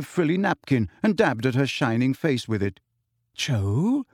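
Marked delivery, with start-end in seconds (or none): none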